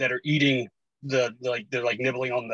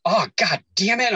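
loud, slow, drawn-out mock exasperation, pitched up; imitated cursing child; 6 semitones higher, 5 dB louder than usual. Dad imitates daughter cursing in the other room, exasperated. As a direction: stretch out the curse like an exasperated kid.

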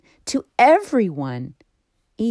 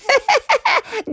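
a very high little giggle; high goat giggle. Acted. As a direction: giggle like a cheeky kid. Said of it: "he giggled".

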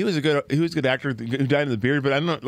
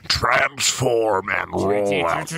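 deep, slow heroic command; hammed-up robot-movie hero; mock robot leader; 5 semitones lower, much slower than usual. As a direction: intone it low and drawn out. Plays Transformers-style hero.

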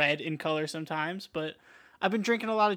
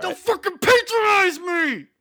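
slow, much louder furious yell; angry shout; 14 semitones higher, 9 dB louder, slower than usual. Acted. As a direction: explode at your co-host. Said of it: "angry sometimes".

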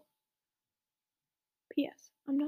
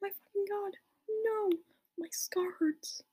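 high, drawn-out cry of pain without volume; hammed-up but restrained pained wail; mock scream; 6 semitones higher, slower than usual. A narrator's reading. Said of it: "he started to scream".

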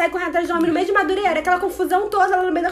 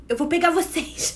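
slow, drawn-out pursuer impression with a laugh; playful slow-motion threat; mock menace; 4 dB quieter, slower than usual. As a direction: stretch it out like a half-hearted bogeyman. Imitating pursuers walking after them.

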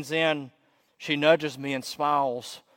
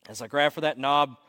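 usual speaking voice

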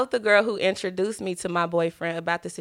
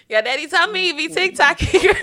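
high, laughing stepmom voice; 8 semitones higher, 5 dB louder than usual. Pretend. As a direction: giggle through a mock auntie line. Mock stepmom talking to kid.

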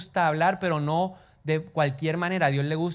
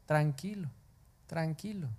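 soft, reassuring and much softer; calming; 7 dB quieter than usual. Retold or imitated. God calming the worried preacher, soft and reassuring. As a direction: soothe yourself gently. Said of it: "Ya Dios me habló".

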